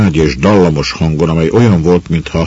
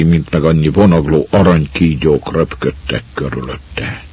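usual speaking voice

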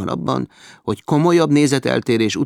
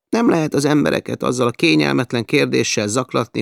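ordinary delivery, nothing marked

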